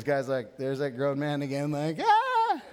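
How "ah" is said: excited yelp. Retold or imitated. Spotting his friend's fire truck.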